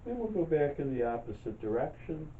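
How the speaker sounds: calm, unhurried meditation guide, long pauses between phrases; guided meditation. Real, not acted. Leads breathing and chair yoga.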